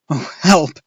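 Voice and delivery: high, loud cry for help; frightened cry. A narrator's reading. Boy cries out as a bat brushes him. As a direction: cry out in fear. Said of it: "I cried".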